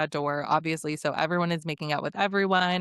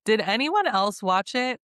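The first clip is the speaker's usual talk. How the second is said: ordinary talk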